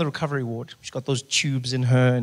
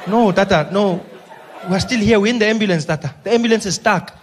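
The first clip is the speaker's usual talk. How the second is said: high, loud anxious driver; Spiwe; 4 semitones higher, 4 dB louder than usual. Acted. Ambulance driver telling old leader they're stuck, panicked. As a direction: urgently explain the trouble. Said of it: "He's there panicking hour after hour".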